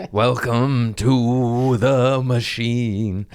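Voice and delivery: slow, loud, drawn-out proclamation; ominous slogan. Pretend. Host intoning a grim corporate motto, slow and loud.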